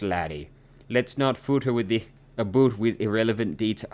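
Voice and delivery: high-pitched Scottish brogue; Scottish accent. Acted. Game character telling investigators to drop it, Scottish accent. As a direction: brush the matter off in broad Scots. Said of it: "do a Scottish accent".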